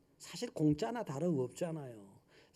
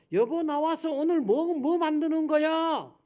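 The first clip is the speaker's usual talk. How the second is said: higher, louder call across the house; 10 semitones higher, 6 dB louder than usual. Retold or imitated. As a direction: call to her from the living room. Husband calls wife again. (거실에서 아내를 부르며)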